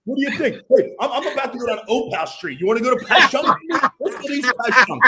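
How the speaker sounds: high, loud, shouted street greeting; mock South Philly bro. Imitating Chad strolling South Philly, loud and laughing. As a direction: hype up the fellas on the corner.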